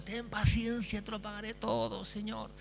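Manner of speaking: pleading servant. Acted. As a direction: beg quietly, voice lifted and fading.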